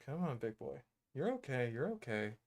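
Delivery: soft, gentle calming. Real, not acted. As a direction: hush it gently. Calming lizard on her shirt.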